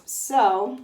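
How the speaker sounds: ordinary talk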